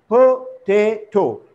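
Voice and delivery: "potato" slowly split into three parts; syllable by syllable. Shown as a demonstration. Teacher showing the three syllables, slowly split. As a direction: draw out each syllable of "potato" separately. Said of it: "this is also once again three syllable word".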